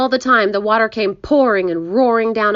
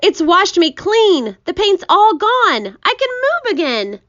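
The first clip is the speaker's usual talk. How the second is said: high, thrilled exclaiming; excited shouting; 11 semitones higher, 4 dB louder than usual. A narrator's reading. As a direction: crow with delight at being free. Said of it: "shouted the centipede excitedly".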